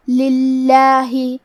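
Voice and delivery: slow chant, للہ with a thin doubled lam and long vowel; thin, light lam. Shown as a demonstration. Teacher shows tarqiq, slow chanted للہ with thin lam. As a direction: stretch للہ keeping the l light. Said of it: "پتلی آواز میں پڑھنا ہے".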